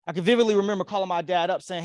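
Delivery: ordinary talk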